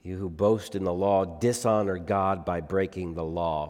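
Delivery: ordinary delivery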